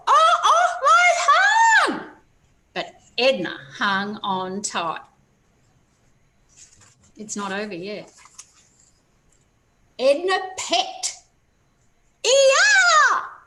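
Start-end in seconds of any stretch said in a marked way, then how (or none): 0.1-2.1 very high, loud, slow wail; pained high cry of my tongue; high pained dino yelp. A narrator's reading. T-Rex in pain. 12.3-13.4 very high loud squealing scream; high pained shriek; dinosaur scream. A narrator's reading. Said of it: "screamed Tyrannosaurus".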